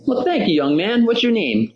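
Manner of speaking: Joe Frazier voice. Retold or imitated. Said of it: "And he's like".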